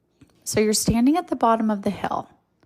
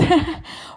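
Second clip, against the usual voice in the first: short bright laugh after the joke; real light laugh; 9 semitones higher than usual. Real, not acted. Host laughing at her willies punchline, short real laugh. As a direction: let a quick real laugh slip out.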